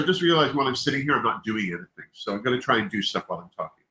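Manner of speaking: ordinary talk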